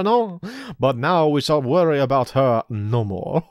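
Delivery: high, hammed-up French accent, slowing down; mock French gloating. Villain gloating that Kid is gone. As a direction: savour her defeat in mock French.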